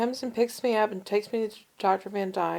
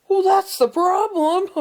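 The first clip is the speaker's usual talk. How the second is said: higher and much louder, blunt verdict; gruff doctor verdict; 11 semitones higher, 10 dB louder than usual. Retold or imitated. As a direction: announce the obvious loudly like a gruff doctor. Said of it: "rough and gruff".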